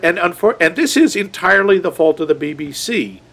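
ordinary talk, nothing marked

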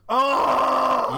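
loud shocked exclamation; outraged gasp. Real, not acted. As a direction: blurt it out in disbelief. Outrage at the Grace Kelly choice.

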